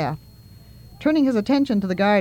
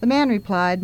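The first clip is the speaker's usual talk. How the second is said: plain reading voice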